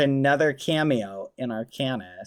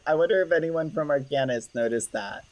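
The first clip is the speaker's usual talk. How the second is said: usual speaking voice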